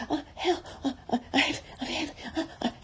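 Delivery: high, panting broken gasps; Piglet out of breath. A narrator's reading. Piglet after running to Christopher Robin, breathlessly panting. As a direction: pant between stammered squeaky syllables. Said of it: "breathing so hard that he could hardly speak".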